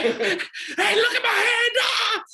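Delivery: very high, loud hammed-up wail; mock agonised shout. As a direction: shriek as if badly hurt, half laughing. Acting out a pretend injury.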